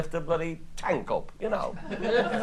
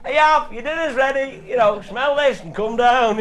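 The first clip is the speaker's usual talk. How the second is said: calling out to dinner; 6 semitones higher, 6 dB louder than usual. Retold or imitated. Wife calling husband to dinner.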